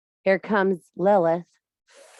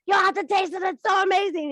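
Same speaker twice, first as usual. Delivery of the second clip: insistent wife; 10 semitones higher than usual. Retold or imitated. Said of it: "I'm like".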